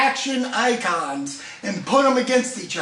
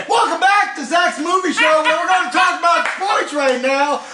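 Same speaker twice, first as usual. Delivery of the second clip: sports announcer hype; 5 semitones higher than usual. Acted. Hyped sports show host.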